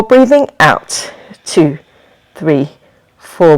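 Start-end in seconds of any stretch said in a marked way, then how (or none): 1.4-3.6 low, evenly spaced count; exhale count. Shown as a demonstration. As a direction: count the breath out on a lowered pitch.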